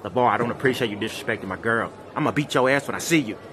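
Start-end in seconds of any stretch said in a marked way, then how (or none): none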